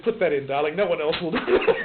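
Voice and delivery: tossing off a posh English "darling", amused; posh English friend. Retold or imitated. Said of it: "grew up posh".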